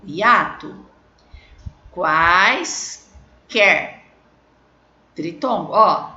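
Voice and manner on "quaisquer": "Quaisquer" with a long first syllable, then a gap; drawn-out syllable division. Shown as a demonstration. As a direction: split "Quaisquer" in two clear beats. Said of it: "Eu separei".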